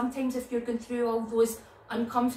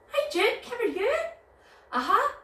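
excited greeting; 12 semitones higher, 4 dB louder than usual. Acted. Greeting puppet dog for children.

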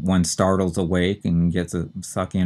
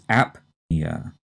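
"apnea" as AP-nee-uh, P clearly sounded; stress on first syllable; 3 semitones lower than usual. Shown as a demonstration. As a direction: hit AP in "apnea", slight break after the P. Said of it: "the stress is on the first syllable".

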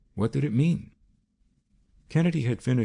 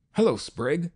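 louder, higher hail across the hangar; calling out; 10 semitones higher, 3 dB louder than usual. A narrator's reading. Kennedy hailing Sprague at the hangar door, called out. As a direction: call out a greeting to a man far off. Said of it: "called out Kennedy".

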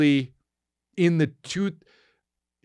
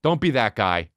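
ordinary delivery

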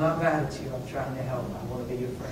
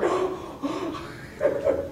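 gasp; 10 semitones higher, 5 dB quieter than usual. Retold or imitated. Overwhelmed by rainbows.

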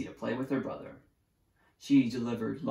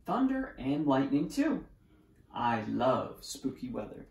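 usual speaking voice

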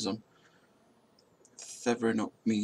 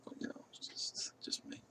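whisper; 14 dB quieter than usual. Real, not acted. Self-deprecating aside to viewers.

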